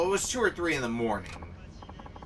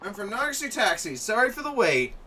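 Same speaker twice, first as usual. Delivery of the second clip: higher and louder, bright service voice; higher-pitched polite driver voice; high cheerful driver greeting; 10 semitones higher, 5 dB louder than usual. Acted. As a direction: greet the customer eagerly.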